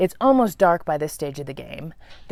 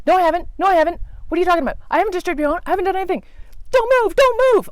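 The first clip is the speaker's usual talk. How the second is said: much higher, frantic pleading; panicked denial; 10 semitones higher, much faster than usual. Retold or imitated. She panics at the spider's accusation, high and frantic. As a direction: beg in a rising panic.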